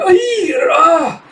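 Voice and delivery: sharp high yelp of pain; pained cry. Acted. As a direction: cry out as the bullets hit. Shot, cries out.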